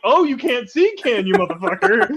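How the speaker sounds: retold father taunting; mock taunting dad. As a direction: tease the kid who can't see. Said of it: "I was like".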